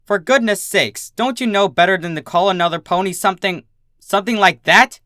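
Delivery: high, loud exasperated teacher; indignant scolding. A narrator's reading. Teacher scolding Dinky outside the school, loud and outraged. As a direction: let the outrage rise in each word.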